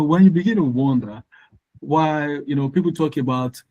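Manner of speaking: ordinary delivery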